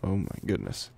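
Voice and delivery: normal talking voice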